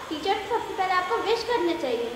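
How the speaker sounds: normal talking voice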